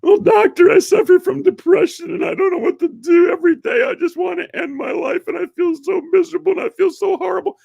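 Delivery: very high, loud mock wail; hammed-up high-pitched misery, run on without stopping; whining depressed patient. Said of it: "I'm not making fun of anybody that has depression".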